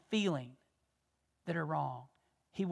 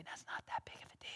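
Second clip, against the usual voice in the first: hushed, quick brush-off whisper; fast mocking whisper of peer talk; whispered dismissal; 15 dB quieter, faster than usual. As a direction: whisper it like a careless friend. Peers' dismissive voice quoted.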